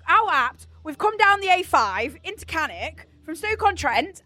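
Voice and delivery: ordinary talk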